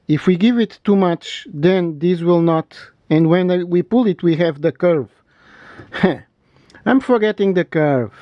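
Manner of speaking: ordinary talk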